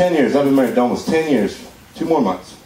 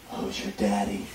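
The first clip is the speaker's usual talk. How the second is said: low murmured come-on, much softer; husky whisper; 9 semitones lower, 12 dB quieter than usual. Retold or imitated. Friend demonstrating the bedroom line, soft low whisper. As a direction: breathe it into her ear. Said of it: "whisper into her ear".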